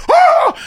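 a sharp, very high yelp of surprise; sudden high gasp. Retold or imitated. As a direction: yelp it out, shocked by delight. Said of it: "and I go".

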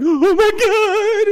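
very high, drawn-out mock wail; mock crying. Acting out the others' crying reactions, mock wail. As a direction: imitate a loud, stretched-out tearful squeal. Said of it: "they're crying".

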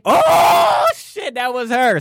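sudden high-pitched cry of recognition; excited outburst. Real, not acted. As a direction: burst out high on a long "oh". Mock outburst at the accent attempt.

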